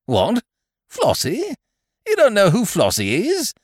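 very high, rising, astonished questions; incredulous. Acted. Ukridge amazed his friend forgets the barmaid, incredulous. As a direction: squawk it in disbelief.

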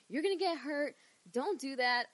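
high, loud mock motherly warning; worried mother. Mother warning her wrestling-mad kid, mock worried. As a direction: imitate mom fretting over the danger. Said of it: "And she's like".

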